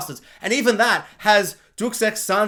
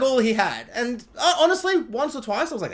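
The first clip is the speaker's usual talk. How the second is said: ordinary talk, nothing marked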